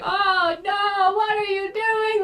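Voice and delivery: high, loud sing-song mock dismay; imitating worried onlookers. Adventurer mimicking the other people at the cave, mock dismay. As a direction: wail it like a hammed-up bystander. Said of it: "all of the other people were like".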